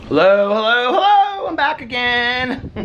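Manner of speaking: high, loud sing-song hellos; sing-song greeting. Acted. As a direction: call out a bright hello to the viewers. Greeting viewers on return.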